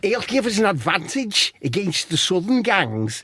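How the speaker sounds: high-pitched put-on Scouse; Scouse accent pushed up high; Wade Rooney. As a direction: do a hammed-up Scouse footballer. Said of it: "I'll do bits, see how you get on".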